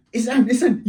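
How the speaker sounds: high, urgent self-identification; acted-out flustered friend; panicked pleading. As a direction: plead to be recognised. Friend pleads with angry dad.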